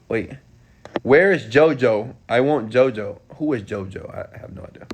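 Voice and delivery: ordinary talk, nothing marked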